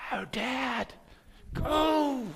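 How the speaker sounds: high, breathless cheer without real volume; mock yelling after the jet, pitched high; retold excited yell. Son cheering his dad's takeoff, high mock yell. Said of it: "And I start screaming and yelling".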